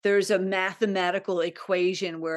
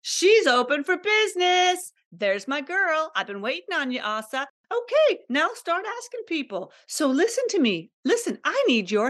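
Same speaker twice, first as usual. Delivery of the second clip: the universe cheering; 9 semitones higher than usual. Retold or imitated. The universe cheering her on for opening up, high and delighted. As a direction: play the universe proudly welcoming her back. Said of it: "the universe is like".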